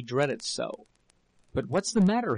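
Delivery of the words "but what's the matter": higher, urgent worried cry. A narrator's reading. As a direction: press her for an answer, alarmed. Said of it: "he cried".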